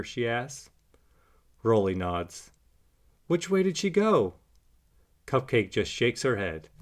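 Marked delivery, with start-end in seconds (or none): 3.3-4.3 high anxious little puppy. A narrator's reading. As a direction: squeak it out urgently. Rolly asks urgently.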